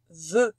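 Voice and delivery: low, held closed vowel in vœu. Shown as a demonstration. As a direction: pronounce vœu carefully on its own. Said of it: "nous allons les prononcer".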